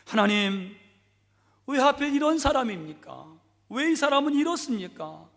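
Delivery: high, loud complaining prayer. Acted. Deaconess complaining to God about her husband, high and loud. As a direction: pour out the grievance to God. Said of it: "막 불평과 원망으로".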